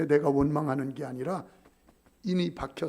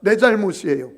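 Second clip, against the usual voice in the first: louder repeat-after-me line; "내 잘못이에요" said loud with stress on 내; loud confession; 2 semitones higher, 11 dB louder than usual. Shown as a demonstration. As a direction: drive it home for the congregation. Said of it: "따라서 합시다".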